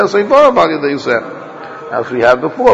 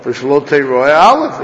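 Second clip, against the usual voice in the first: usual speaking voice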